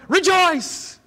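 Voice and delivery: high, bright shout of "Rejoice!"; joyful greeting. Acted. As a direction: greet a fellow believer with bursting joy. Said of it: "greet each other by going".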